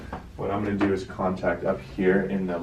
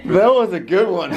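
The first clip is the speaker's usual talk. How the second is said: high, loud laughing; laughing hard; 14 semitones higher, 7 dB louder than usual. Real, not acted. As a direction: burst out laughing. Laughing at big crack.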